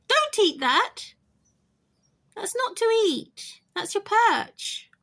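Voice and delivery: high, loud pet-talk reprimand; scolding pet bird. Real, not acted. Owner stops bird eating its perch, high scolding.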